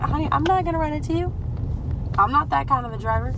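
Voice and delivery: mock indignant driver; sassy retort. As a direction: talk back with sass at the lady. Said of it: "I'm like".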